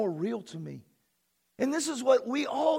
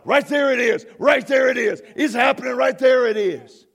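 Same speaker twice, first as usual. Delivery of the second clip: loud, insistent, punched repetitions; forceful, exultant declaration; triumphant shouting; 8 dB louder than usual. Real, not acted. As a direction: hammer it out like a preacher at the climax. Climax of prayer.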